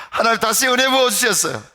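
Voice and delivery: loud, high testimony of grace; joyful proclamation. Acted. Preacher telling the church God poured grace again, loud. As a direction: announce it triumphantly.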